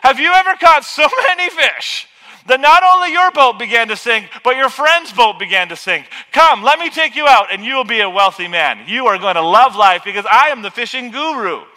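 hammed-up boastful sales pitch, loud; loud pretend charter salesman; mock fishing salesman pitch. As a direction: sell the fishing charter like a huckster. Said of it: "He could say".

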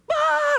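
loud, high-pitched imitation cry; mock wail. Preacher imitating the woman bursting in crying, mock wail. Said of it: "comes in and starts crying".

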